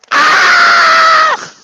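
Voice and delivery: very high, loud drawn-out scream; imitated scream. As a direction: mimic a rider screaming down the road. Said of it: "He's going".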